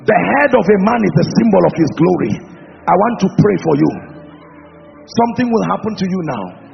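low, soft, intimate prayer; hushed prayer. Real, not acted. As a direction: pray softly and low.

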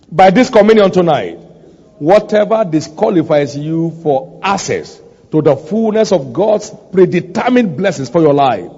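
commanding pulpit prayer; declarative preaching prayer. Real, not acted. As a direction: pronounce decrees over a congregation, rising to a climax. Prays decrees over the congregation.

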